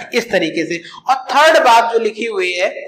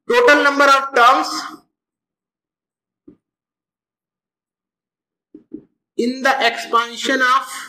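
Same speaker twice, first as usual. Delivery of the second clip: slow dictation; much slower than usual. Shown as a demonstration. Teacher dictating notes to class.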